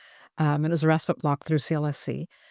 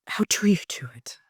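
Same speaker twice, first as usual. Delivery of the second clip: whispered; 2 semitones higher than usual. Real, not acted. Asks nurse through emotion.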